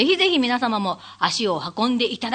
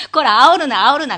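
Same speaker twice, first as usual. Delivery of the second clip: louder, exasperated scold; scolding shout; 4 semitones higher, 9 dB louder than usual. Retold or imitated. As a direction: rein in the crowd-stirring performer. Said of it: "みたいな感じでしたよね". (呆れて叱る声)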